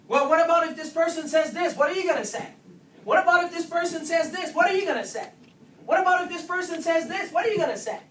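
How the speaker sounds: loud, rapid-fire quizzing of the staff; drill questions. Acted.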